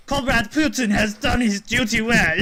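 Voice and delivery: put-on pompous state-loyal Russian accent; hammed-up Russian accent; mock Russian accent. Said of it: "she's like".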